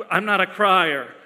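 ordinary delivery

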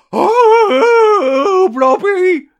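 long, very high loud wail; drawn-out high-pitched comic howl; mock howling. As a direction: howl it out like a creature in the night. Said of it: "he was just howling at night".

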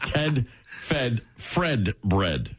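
crawl through each word; painstaking slow retry. Shown as a demonstration. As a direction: spell it out very slowly. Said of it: "Lost that one".

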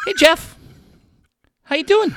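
high-pitched cartoon-mouse hello; Bob Nightingale. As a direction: greet in a mock Mickey Mouse squeak. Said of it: "it sounds like Mickey Mouse".

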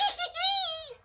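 very high squealing giggle; giddy giggle. Real, not acted. Giddy before playing the clip.

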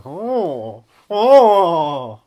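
loud rising mock croon; put-on swooping "oh". Sidling up to the co-host, put-on crooned "oh". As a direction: swell into an exaggerated "oh". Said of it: "saddle up to you and go".

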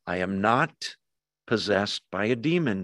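low, measured, composed denial; very calm. Acted. Jesus calmly answering his accusers' demon charge, very calm. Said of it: "he says this very calmly".

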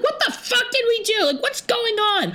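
very high, squealed disbelief; hammed-up wail of injustice; outraged protest. Customers protesting as they are kicked out, high outraged.

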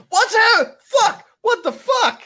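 the struck mechanic yells high in panic; panicked yelling. Acted.

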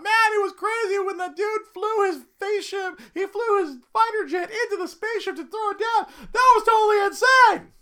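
shrill-high shouted movie spoiler; loud, very high-pitched gushing at full volume; excited screaming. Retold or imitated. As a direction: holler it like an overexcited teenager. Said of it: "screaming about".

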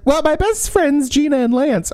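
hammed-up innocent claim; squeaky fake-cheery voice; mock chirpy voice. Mocking character's claim.

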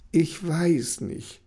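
mild hesitant sigh, slow. Acted. Kenny hesitates about the growth box, sighing slowly. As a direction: drag it out with a sigh. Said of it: "seufzte Kenny".

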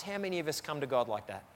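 ordinary delivery

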